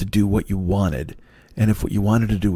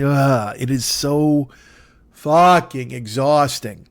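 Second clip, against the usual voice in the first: exasperated; 4 semitones higher, 3 dB louder, much slower than usual. Real, not acted. Venting about cooking stress.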